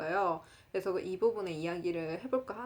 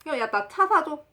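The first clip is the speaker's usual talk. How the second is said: loud, higher-pitched pushy friend; 조르는 친구 흉내; 6 semitones higher, 7 dB louder than usual. Retold or imitated. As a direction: blurt out a big demand, imitating a friend. 친구의 갑작스러운 요구 흉내. (친구가 크고 높게 조르듯)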